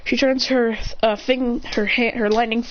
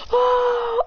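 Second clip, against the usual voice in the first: high grunt; 13 semitones higher than usual. Acted. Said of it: "He goes".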